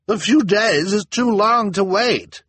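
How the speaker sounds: high, loud, drawn-out complaint; impatient townswoman. A narrator's reading. Missus Morrow urges the elder to hurry, indignant cry. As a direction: object shrilly and impatiently. Impatient woman in angry crowd.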